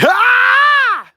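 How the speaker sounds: high loud laughing yelp; delighted shout. Real, not acted. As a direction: burst out with a laughing yell. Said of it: "I enjoyed the hell out of doing that".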